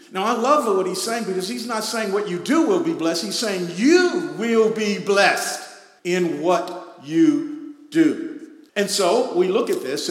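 loud emphatic preaching; full-voiced sermon emphasis. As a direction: drive the point home from the pulpit.

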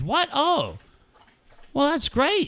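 high-pitched, delighted surprise, imitated; excited son. Dad retelling his son's reaction to the expansion team, excited. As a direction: play the son's pleased astonishment.

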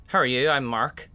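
lightly imitated Northern Irish accent; Northern Ireland accent. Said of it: "I hear this Northern Ireland accent".

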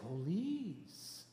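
soft, gentle imitation; Pastor Timothy. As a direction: answer softly, as a gentle pastor would. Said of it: "he's so gentle when he talks".

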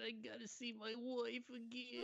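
high, whiny pleading to God; mock whiny prayer. Mocking the husband who wants his wife back, high whiny plea. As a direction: ham up a desperate man begging.

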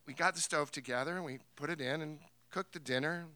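ordinary talk, nothing marked

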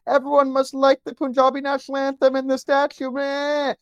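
high whining mock voice; whiny crybaby. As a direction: whine it like a sulky kid. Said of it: "sit there and cry and you're like".

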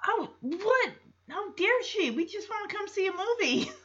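high, soft wounded protest; acted-out betrayed little voice; mock betrayal. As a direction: plead in imitated hurt disbelief. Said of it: "The look of betrayal on Stephanie's face".

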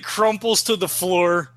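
ordinary delivery

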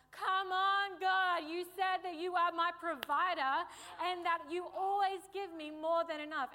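high, loud exasperated appeal; loud pleading. Retold or imitated. Preacher re-enacting a plea to God for provision, loud pleading. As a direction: cry out to God in retold frustration.

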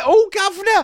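high, loud cockney urchin voice; cheeky scrappy street kid calling out. Retold or imitated. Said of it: "you can do your voice".

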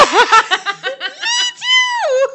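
high shrieking laugh; laughing squeal. Real, not acted.

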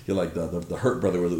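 ordinary delivery

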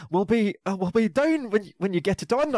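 mock Attenborough's slip into Scottish; ultra-Scottish accent. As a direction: put on a sudden strong Scottish accent. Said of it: "he says".

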